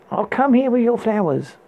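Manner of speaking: high, coy maiden calling down; lightly voiced young woman beckoning; Rapunzel voice. A narrator's reading. Princess teasing the prince from her window, coy.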